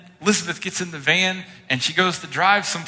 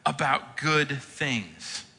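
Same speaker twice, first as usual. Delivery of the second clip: very slow, soft, deliberate; slow emphasis; 5 dB quieter, much slower than usual. Real, not acted. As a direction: stretch out good things slowly and softly. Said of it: "slowed emphasis".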